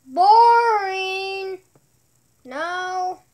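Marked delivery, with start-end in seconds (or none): 0.1-1.6 loud mock groan of boredom; loud boring. Real, not acted. Kid mocking channel name.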